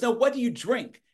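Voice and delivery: Carl Killingsworth. Retold or imitated. Southern man rejects Coke and asks again, imitated insistent Southern accent. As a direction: imitate a Southerner pressing for a real answer. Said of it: "that's how Carl talked".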